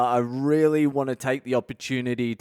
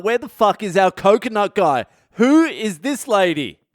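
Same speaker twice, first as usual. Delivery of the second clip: higher, louder, stretched in disbelief; baffled outrage; 7 semitones higher, 6 dB louder than usual. Retold or imitated. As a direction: other family wondering where their man went. Other family gets wrong body.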